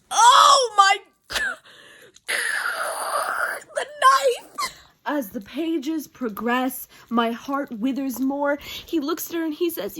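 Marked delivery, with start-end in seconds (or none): 0.1-4.8 loud, high, drawn-out strangled shriek; overwhelmed shriek. Real, not acted. Reader screaming over the knife scene, overwhelmed shriek. Reacting to the read line.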